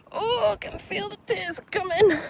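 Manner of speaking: high, squeaky near-crying wail; mock tearful. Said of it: "I can feel myself starting to cry".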